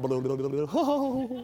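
low, quiet breathy laugh; soft chuckle. Real, not acted. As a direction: laugh to yourself at the memory. Laughing at pizza memory.

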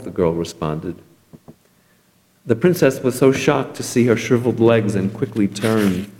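ordinary delivery

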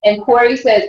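normal talking voice

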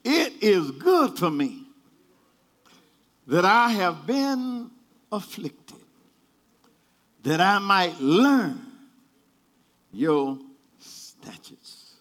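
slow, weighty scripture reading; scripture recitation. Acted. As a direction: lay each phrase down slowly. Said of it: "David said".

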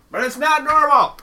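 high, loud outburst; exasperated shout. Real, not acted. Rebutting people trained to accept it, exasperated shout. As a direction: yell it in protest.

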